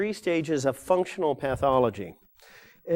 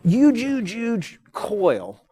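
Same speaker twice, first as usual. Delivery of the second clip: huge, huge, huge stressed higher and louder each time; huge repeated, pitched up and punched loud; emphatic huge; 8 semitones higher, 5 dB louder, much slower than usual. Real, not acted. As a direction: lean hard on every huge to show the size. Stressing the coil's huge size.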